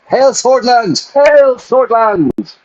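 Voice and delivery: high, slow, loud patriotic cry; rallying salute. Acted. Soldier salutes the president, loud patriotic cry. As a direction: bellow it like a soldier saluting the nation.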